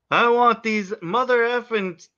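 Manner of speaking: hammed-up Sam Jackson rant; Sam Jackson impression. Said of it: "most Sam Jackson thing ever".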